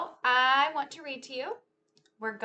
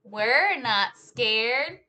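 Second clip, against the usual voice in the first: lively, sing-song storytime read; animated picture-book telling; reading to children; 3 semitones higher, 11 dB louder, slower than usual. Acted. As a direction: act out the story for a class of kids. Teacher retells picture book with moves.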